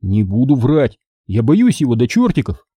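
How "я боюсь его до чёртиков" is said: ordinary delivery, nothing marked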